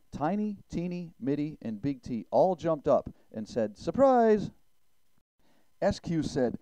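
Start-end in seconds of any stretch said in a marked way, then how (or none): 3.8-4.5 high, loud reveal; spring the surprise with a big, high shout; gleeful surprise shout. A narrator's reading. Friends jump up to reveal trick.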